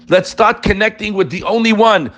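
normal talking voice

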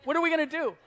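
put-on pleading to God about the kid; exasperated plea. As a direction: at the end of my rope. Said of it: "I said".

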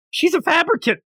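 a gasping high-pitched accusation; shocked squeal. Retold or imitated. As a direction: blurt it out in shock. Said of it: "They're like".

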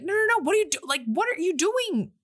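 high, urgent, protesting sister voice; mock panic, no no no; Alex pleading. Alex begs her sister to save Coventry, high and urgent. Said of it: "So now Alex is like".

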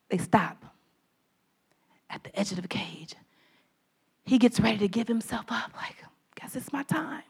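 hushed, unvoiced storytelling whisper. A narrator's reading. Preacher whispers the moment the hunters stop. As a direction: drop to a breathless whisper. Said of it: "whispered climax of story".

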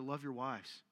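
preacher to men, soft low-voiced plea; soft, low take. Shown as a demonstration. Men in church urged gently, soft and low. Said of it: "I don't need, love your wives".

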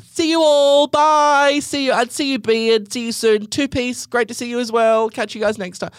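very high, loud, sing-song farewells to everyone; wildly overdramatic goodbye. Retold or imitated. Narrator mockingly bids the bouncer and girl farewell, high and theatrical. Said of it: "wildly overdramatically".

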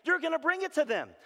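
high, loud, impassioned preaching; full-force preaching. Acted. Preacher urging hurting listeners to ask for help, full-force preaching.

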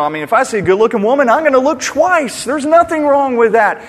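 fast, high, loud brush-off; dismissive scoff. Retold or imitated. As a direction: laugh it off as nothing. One interviewer brushes it off.

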